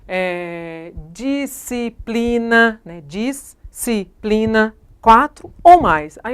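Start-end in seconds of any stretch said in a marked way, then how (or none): 1.1-2.8 "disciplina" drawn out slowly in separate syllables. Shown as a demonstration. Teacher splitting word into syllables. 3.0-4.8 "Disciplina" very slow, each syllable set apart. Shown as a demonstration. Teacher splitting word into syllables.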